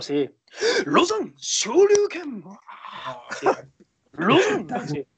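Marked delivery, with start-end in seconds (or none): 0.9-2.4 high-pitched battle cry; drawn-out, high martial-arts yell; anime attack shout. Acted. Imitates the anime character's attack shout. 4.1-4.7 high, swooping anime yell; high, loud hero call; anime battle cry. Acted. Imitates anime character's shout.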